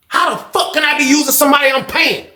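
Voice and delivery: high-pitched incredulous comeback; indignant retort. Retold or imitated. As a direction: throw the accusation right back. Retold indignant comeback in argument.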